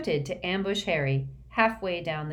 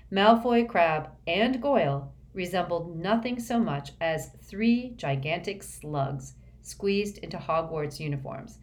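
ordinary delivery, nothing marked

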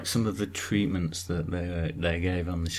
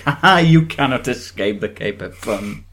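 mock villain voice; 2 semitones higher, 6 dB louder than usual. Imagined cape attacker quote.

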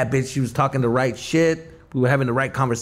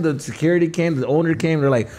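normal talking voice

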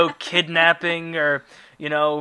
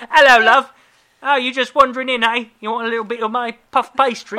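high, loud, hammed-up cheeky British accent; mock British baker; 8 semitones higher, 10 dB louder than usual. Baker chatting up a female customer, hammed-up British cheekiness. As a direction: flirt like a cartoon British street vendor.

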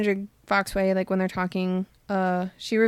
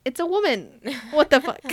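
retold shock; 4 semitones higher than usual. Friend retelling Gansey's discovery in the crypt, shocked. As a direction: act out a stunned, higher-pitched outburst.

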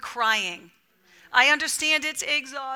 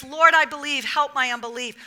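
normal talking voice

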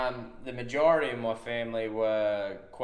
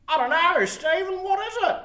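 high, loud innocent voice of a clueless mate; clueless Ray; 8 dB louder, faster than usual. Retold or imitated. Mate at smoko asking Steve about the pump, high and innocent. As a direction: play dumb, pitched way up.

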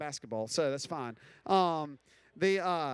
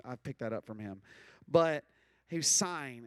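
ordinary delivery

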